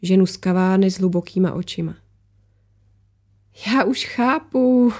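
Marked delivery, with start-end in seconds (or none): 3.7-4.9 slow, higher, slightly choked amusement; strained laughing. A narrator's reading. Laura thinks she understands the plot, mildly strained amusement. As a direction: draw it out as if stifling a laugh. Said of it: "rozesmála se Laura poněkud přiškrceně".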